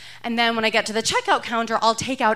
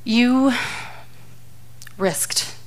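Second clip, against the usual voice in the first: disappointed husband. Retold or imitated. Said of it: "my husband's disappointed voice saying".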